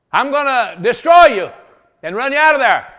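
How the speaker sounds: put-on loud high boyish threat; defiant threat. David shouting his threat up at the Jebusite wall. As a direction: shout it up at them, fearless.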